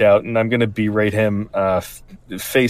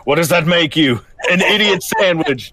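Gordon Ramsay; 9 semitones higher than usual. Retold or imitated. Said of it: "Gordon Ramsay".

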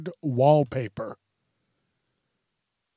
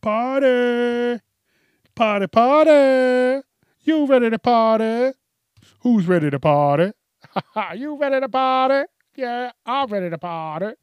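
loud, high party hype voice; rowdy party animal; 8 semitones higher, 7 dB louder than usual. Pretend. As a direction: whoop it up like a party host. Hyping a pretend party night.

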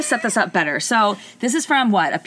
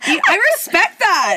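laughing; 11 semitones higher, 5 dB louder, slower than usual. Real, not acted. Both laughing at the story.

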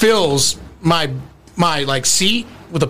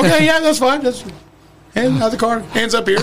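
nervous compliance; 4 semitones higher than usual. Retold or imitated.

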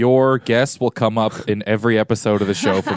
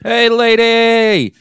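high, loud, drawn-out holler; calling out; 11 semitones higher, 5 dB louder, much slower than usual. Acted. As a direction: call out to a woman leaving, stretching the words. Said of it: "scientist number one calls out to her".

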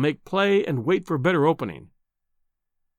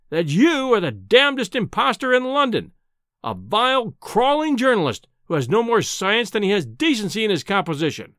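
higher-pitched, furious denunciation; blast of fury; 7 semitones higher than usual. A narrator's reading. Professor denouncing a journalist as an impostor, furious. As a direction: hurl the insults in a rage. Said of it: "with a sudden blast of fury".

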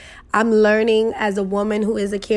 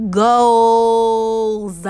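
long sassy Goals; drawn-out goals; 3 dB louder than usual. Shown as a demonstration. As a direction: stretch the word Goals long and falling. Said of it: "is goals".

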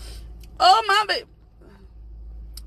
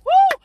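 high, loud "woo"; excited whoop; 10 semitones higher, 7 dB louder than usual. Real, not acted. Preacher punctuating a point, excited whoop. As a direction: let out a sudden high whoop.

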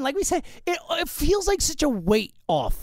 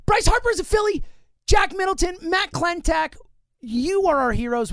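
triumphant; 6 semitones higher than usual. Real, not acted. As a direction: proclaim it proudly. Triumphant sign-off.

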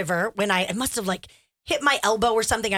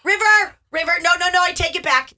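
loud, raised, urgent retraction; mother calling out; 6 semitones higher, 6 dB louder than usual. Retold or imitated. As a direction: holler upstairs to stop the child. Re-enacts mother calling up to son.

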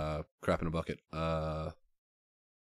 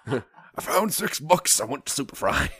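homeless-guy voice; 6 semitones higher, 5 dB louder than usual. Acted. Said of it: "that's my homeless voice".

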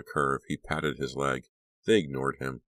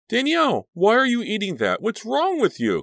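much higher, louder alarmed cry; 7 dB louder than usual. A narrator's reading. Cliff seeing Danielle eat raw meat, crying out in alarm. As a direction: cry out in shock at what she's doing. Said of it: "Cliff cried in alarm".

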